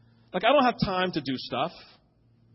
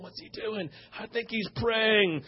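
much softer, hushed puzzled aside; puzzled parents murmuring; 4 semitones higher, 5 dB quieter, slower than usual. Retold or imitated. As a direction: murmur it like onlookers who don't want to be heard.

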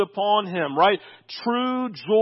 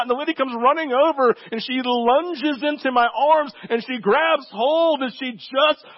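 normal talking voice

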